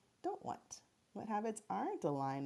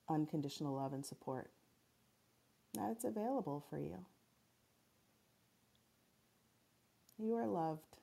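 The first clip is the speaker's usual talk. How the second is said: sleep story narrator. Acted. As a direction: gently guide them into calm. Leads a guided meditation.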